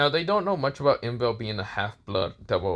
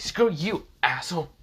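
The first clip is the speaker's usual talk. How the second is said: pitched-up snap of annoyance; angry retort; 7 semitones higher than usual. A narrator's reading. Irina snapping at Issei for calling her Iron, angry. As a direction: bite back at him. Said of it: "glares at him and says".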